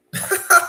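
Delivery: sharp high, loud mock laugh; a quick taunting burst of laughter. Friend laughing down at him before leaving, mock laugh. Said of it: "He's like".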